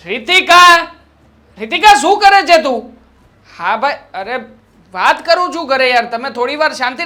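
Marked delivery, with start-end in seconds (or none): 0.1-3.0 calling out. Acted. Husband calling wife from another room.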